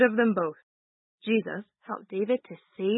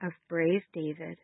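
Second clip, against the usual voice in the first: prayer; 3 semitones higher, 3 dB quieter than usual. Acted. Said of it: "you can repeat after me".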